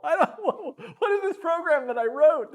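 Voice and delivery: high, laughing disbelief. Real, not acted. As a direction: laugh through the question. Laughing at own old program.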